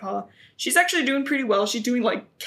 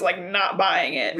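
normal talking voice

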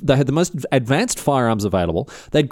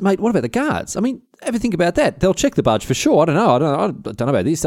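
a touch worried, pitched higher; mock-nervous doubter, higher up; nervous retold voice; 5 semitones higher than usual. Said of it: "he goes".

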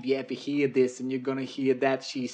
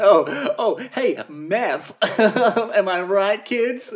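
higher, louder, hammed-up pandering to kids; mock cool adult; 9 semitones higher, 7 dB louder, much slower than usual. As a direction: pretend to be a try-hard grown-up winking at kids. Mocking hip adult talking to kids.

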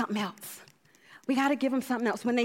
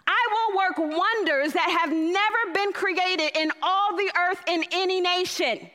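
reading God's words; 4 semitones higher than usual. Acted. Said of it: "Let me tell you what it says in the Message".

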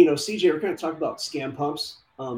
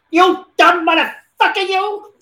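much higher, much louder mobster rant; Joe Pesci; 11 dB louder, slower than usual. Acted. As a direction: scream it like an unhinged movie gangster. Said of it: "Do your Joe Pesci".

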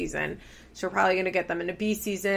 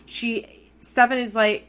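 ordinary talk, nothing marked

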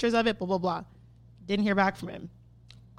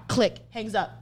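ordinary talk

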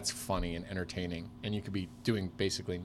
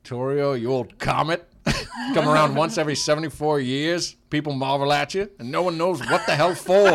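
louder, higher roast; old-money roast; 5 semitones higher, 10 dB louder than usual. Pretend. A rich man roasting a friend at a gala, louder. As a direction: rib your friend grandly at a gala. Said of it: "They're like".

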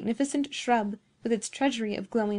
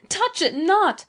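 high, loud cry of warning; agonized cry; 9 semitones higher, 7 dB louder, slower than usual. A narrator's reading. Beatrice stops Giovanni touching the shrub, agonized cry. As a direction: cry out in anguish, pitched well up. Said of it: "in a voice of agony".

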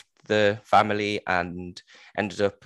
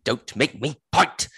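higher, slow teasing threat; mock witchy threat; 5 semitones higher, slower than usual. Mother threatening her daughter, mock slow. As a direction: draw it out like a witch's warning. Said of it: "She says".

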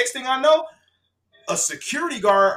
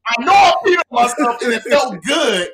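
louder, laughing half-shout; laughing at himself inside his head; mock guilty confession; 4 semitones higher, 8 dB louder than usual. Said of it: "in my head, I'm like".

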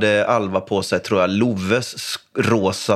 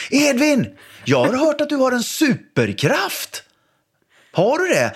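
high, theatrical voice; playful grand voice; 12 semitones higher than usual. Retold or imitated. Dad asking his son about his superpower, high theatrical voice. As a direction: hype up the child's superpower.

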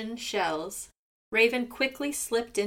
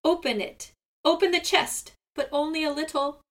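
squawking; 6 semitones higher, 3 dB louder than usual. A narrator's reading. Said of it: "squawked Seagull".

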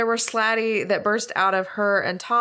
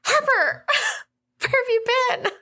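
excited shout. Acted. Character shouts at returning friend.

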